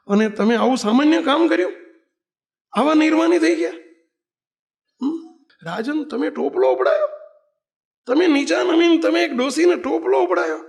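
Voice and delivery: high, loud amazed exclamation; amazed praise. Retold or imitated. Courtiers to king, amazed and loud. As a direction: marvel at the king's humility.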